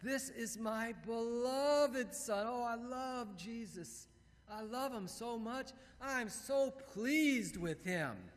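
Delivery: high, slow, adoring gush; God the Father. Acted. Appreciative Father speaking of Jesus, slow doting delight. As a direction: dote on him, drawing out every phrase. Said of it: "appreciative Father".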